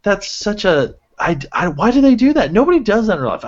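high, loud exasperated rant; rising outrage at the jump hugs. Real, not acted. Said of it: "ranting about the hug".

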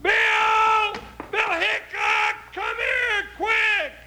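high, loud shout across the street; calling out. Acted. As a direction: yell urgently for Bill to come quick. Calling urgently for his partner.

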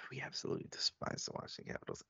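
fast hushed whisper. Real, not acted. Host confiding rivalry hatred to listeners, whispered. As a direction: hiss it like a secret grudge.

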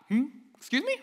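very high, incredulous squeak of a question; taken aback. Acted. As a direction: react in disbelief, pitch leaping up. Voicing shocked hearers of Jesus' claims.

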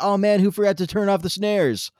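high, loud mock dismay; complaining bandmate. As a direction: imitate an exasperated musician calling out a mistake. Acts out complaining band member.

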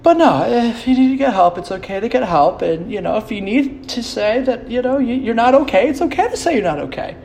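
high, syrupy mock concern; mocking the mandatory mental-health disclaimer, high and syrupy; mock sincere mental-health message. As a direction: parody a preachy self-care post. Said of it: "you have to do now".

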